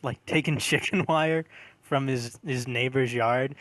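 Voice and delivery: ordinary talk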